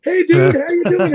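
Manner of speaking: a pumped-up, mock-friendly dude greeting; Scott's greeting. [name] greets on video.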